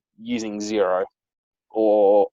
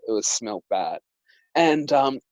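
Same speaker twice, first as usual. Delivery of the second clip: usual speaking voice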